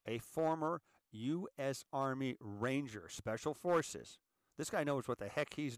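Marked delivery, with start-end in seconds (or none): 0.1-4.2 measured, drawn-out delivery, each part set apart; slow and deliberate. Shown as a demonstration. Host repeats the senator's military background so it sinks in, slowly. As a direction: say it slowly for emphasis. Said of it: "Let's say that slowly again".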